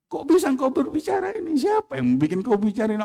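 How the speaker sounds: quick startled asking; amazed, rushed question. Acted. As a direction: blurt it out in wonder. Abu Yazid amazed at talking dog.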